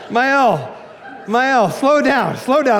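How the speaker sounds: high slow laughing plea. Retold or imitated. Pastor to wife inviting neighbors, laughing plea. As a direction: plead with a laugh.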